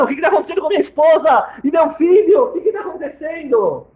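high, loud, fast frantic shouting; panicked shout. Acted. Husband outside the delivery room shouting in panic. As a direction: yell out in fear for wife and child. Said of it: "Moishe tá preocupado".

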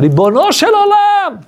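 a drawn-out, high pleading shout; crying out to God. Retold or imitated. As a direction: cry out to heaven in desperation. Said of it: "Ele começa a gritar".

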